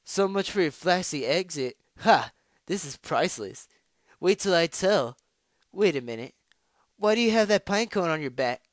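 loud, high, mocking character voice; brash, smug jeering; gloating rival. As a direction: crow over her downfall, then challenge her.

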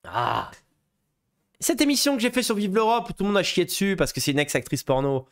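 ordinary talk, nothing marked

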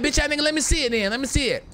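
telling a rival to show his car; retold challenge. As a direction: dare him to prove it. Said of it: "I'm like".

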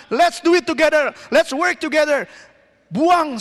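high, emphatic exhortation with shouted commands; forceful preaching. Real, not acted. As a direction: command the congregation like a fired-up preacher. (khotbah berapi-api, lantang)

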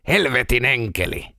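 a pained curse spat out; cursing. A narrator's reading. Peltonen hurt by the fallen angel statue, pained cursing. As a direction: swear at the statue through the pain. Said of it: "Peltonen manasi".

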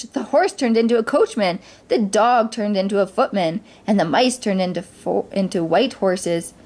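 usual speaking voice

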